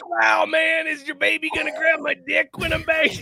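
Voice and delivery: very high, loud cartoon slacker voice; mock English accent. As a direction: holler it in a hammed-up Shaggy voice. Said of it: "That was my English accent".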